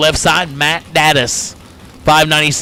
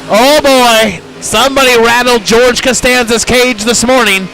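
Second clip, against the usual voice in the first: excited announcer; 7 semitones higher, slower than usual. Real, not acted. Announcer reacts to big upset.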